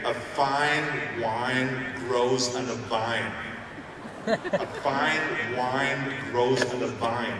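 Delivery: slow, careful diction practice; drawn out word by word, like accent class; elocution drill. Shown as a demonstration. Practising American speech, slow, drilled. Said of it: "I said over and over again".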